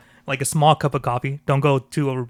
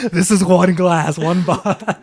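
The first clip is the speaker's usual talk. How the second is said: high, loud, laughing imitation; mock insistence; 5 semitones higher, 6 dB louder, slower than usual. Friend mimicking wine drinkers drowning in one glass, mock insistence. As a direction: stretch it out in comic self-justification.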